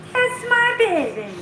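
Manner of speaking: squeaky high coo to the puppy; very high pet voice. Real, not acted. Cooing to the puppy.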